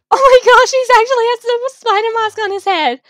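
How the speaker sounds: high, loud excited exclamation; loud, high-pitched surprise; amazed. Real, not acted. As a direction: burst out in astonishment. Amazed character wears the mask.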